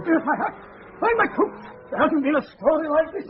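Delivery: bursting with the scoop, words tumbling up high; breathless newsman's thrill, pitched high; excited. Acted. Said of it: "pray compose yourself".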